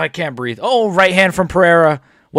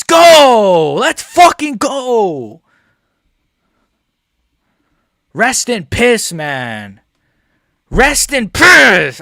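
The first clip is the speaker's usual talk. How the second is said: much higher, louder slow shouts; triumphant shouting; 4 semitones higher, 4 dB louder, much slower than usual. Real, not acted. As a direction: roar it, drawn out. Celebrating again.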